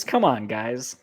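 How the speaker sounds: ordinary talk, nothing marked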